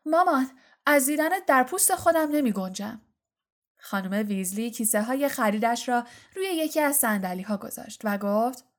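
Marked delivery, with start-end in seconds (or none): 0.0-3.0 higher, hammed-up joy; feigned delight. A narrator's reading. Twin pretending to just meet his mother, mock overjoyed. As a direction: gush theatrically at mother. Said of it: "انگار تازه مادرش رو دیده باشد".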